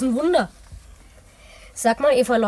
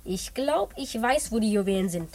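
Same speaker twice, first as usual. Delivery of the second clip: much softer, hushed and low; secretive hush; 5 semitones lower, 7 dB quieter than usual. Acted. Boy confiding the loot's hiding place, hushed. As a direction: let the secret out under your breath.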